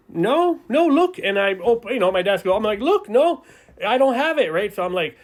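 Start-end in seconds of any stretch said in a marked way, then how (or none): 0.1-1.2 high, louder nervous protest; panicked denial. Acted. Kid denying theft to teacher. 2.8-4.5 high, louder nervous protest; panicked denial. Acted. Kid denying theft to teacher.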